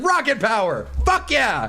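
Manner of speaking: high-pitched hyped-up cheer; excited. Retold or imitated. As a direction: act out getting pumped by a theme song. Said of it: "I'd get excited".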